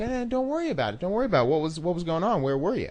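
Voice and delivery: high-pitched, dismissive don't-worry; breezy reassurance. Retold or imitated. Rep brushes off a volunteer's apology, high and breezy.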